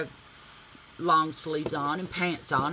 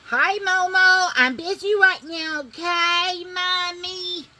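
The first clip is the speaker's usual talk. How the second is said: pet talk; 12 semitones higher, 7 dB louder, slower than usual. Real, not acted. Talking to her dog.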